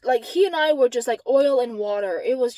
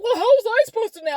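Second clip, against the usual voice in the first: high, fast, loud mock protest; rushed, high-pitched defensive whine; 11 semitones higher, 6 dB louder, faster than usual. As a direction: imitate an indignant excuse. Imitates his defensive reply.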